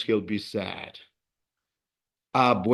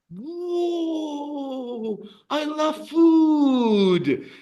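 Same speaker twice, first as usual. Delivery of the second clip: excited shout; 11 semitones higher, much slower than usual. Acted. Teacher gushes to the class about food, excited shout. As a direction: shout la comida like a hungry fan. Delighted exclamation over food.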